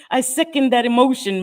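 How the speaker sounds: ordinary talk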